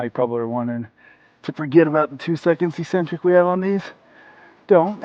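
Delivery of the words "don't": firm command. Real, not acted. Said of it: "urging viewer on".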